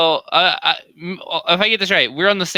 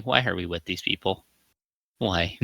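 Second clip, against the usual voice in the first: depressed mumble; 4 semitones lower, 8 dB quieter than usual. Retold or imitated. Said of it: "depressingly talking to Infernal".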